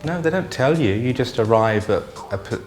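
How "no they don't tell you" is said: ordinary delivery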